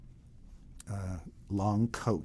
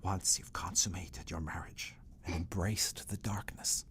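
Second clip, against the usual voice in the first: conspiratorial whisper; 7 dB quieter than usual. Acted. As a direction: whisper it like a secret plan. Escher whispering his scheme.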